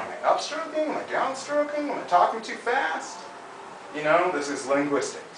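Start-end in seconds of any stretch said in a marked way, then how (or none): 0.0-3.2 high, rising self-doubting questions; mock anxious self-check; high inner questioning. Coach voices Hugh's self-questions, high. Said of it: "you gotta think sometimes".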